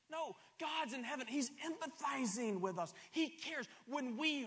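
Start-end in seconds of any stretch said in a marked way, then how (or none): none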